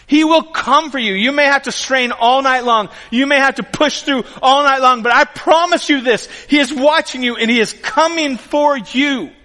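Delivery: high, loud, fervent promise; impassioned preaching. Real, not acted. Preacher promises a struggling congregation that help comes, impassioned preaching. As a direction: preach it out with rising conviction.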